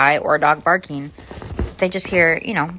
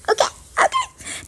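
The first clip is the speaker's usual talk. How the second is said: very high, breathless whispered okays; squeaky whispered okay. Retold or imitated. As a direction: squeak it out in disbelief. Reacting to husband's denial.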